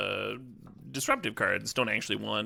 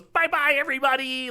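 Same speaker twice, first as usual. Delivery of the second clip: put-on much higher, louder little-kid farewell; bright happy child; 5 dB louder than usual. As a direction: chirp it like a cheerful toddler waving goodbye. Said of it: "all bright and happy, and goes".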